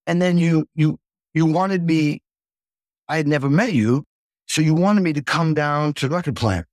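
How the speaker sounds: ordinary talk, nothing marked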